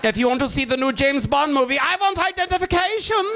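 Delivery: high, strict German official; German accent. Pretend. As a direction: bark it like a border guard. Mock German official demanding ID.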